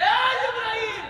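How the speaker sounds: high, drawn-out call; high hailing call, voiced for God. God calls out to the angel.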